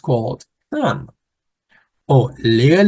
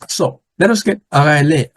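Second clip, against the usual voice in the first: ordinary delivery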